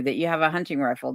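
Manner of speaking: ordinary talk, nothing marked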